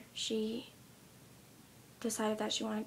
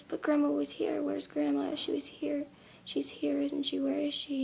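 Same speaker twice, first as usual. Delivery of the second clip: lightly voiced upset little girl, higher; upset child; 4 semitones higher than usual. Little girl questions her mom about grandma, mildly upset. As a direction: insist like a confused child.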